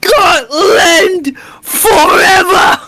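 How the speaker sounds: long, high, drawn-out war cry; battle cry. Acted. Player rallying for Scotland, in a huge drawn-out battle cry. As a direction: roar it like a clansman charging.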